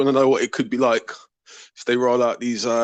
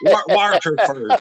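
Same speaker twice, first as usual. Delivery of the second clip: slow, louder try at Irish "Waterford"; attempted Irish accent; 5 semitones higher, 3 dB louder, slower than usual. Shown as a demonstration. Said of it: "Irish accent".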